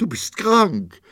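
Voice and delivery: high, loud, breaking outcry; wife cries out to her sick husband, sobbing; sobbing cry. A narrator's reading. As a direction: cry it out between sobs. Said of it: "Sie schluchzte und bebte".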